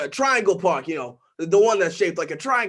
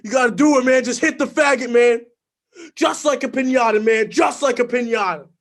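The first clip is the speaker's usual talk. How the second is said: louder pushy egging on; urging; 4 semitones higher, 6 dB louder than usual. Retold or imitated. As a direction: press him to do it. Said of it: "he turns to me and he says".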